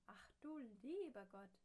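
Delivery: put-on a startled cry of a small old man; dismayed little man. Herr Matzen, blown away in his house, startled dismay. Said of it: "dachte der kleine Herr Matzen".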